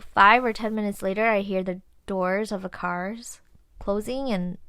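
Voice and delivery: ordinary talk, nothing marked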